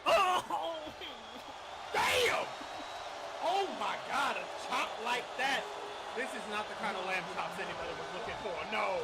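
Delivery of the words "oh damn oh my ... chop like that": loud startled oh; shocked yell. Real, not acted. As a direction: react with a sudden shocked cry. Commentator reacts to brutal move.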